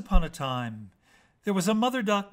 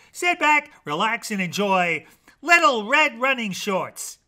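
ordinary delivery

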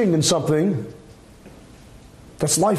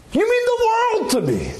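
overly effusive. Shown as a demonstration. To a child, gushing you mean the world, far too effusive. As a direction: gush it way over the top. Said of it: "you don't have to be so effusive".

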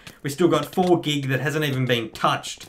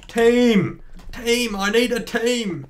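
calling out; 7 semitones higher, much slower than usual. Real, not acted. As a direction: shout for your team. Calling out for teammates.

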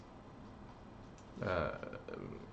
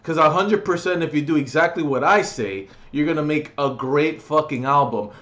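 normal talking voice

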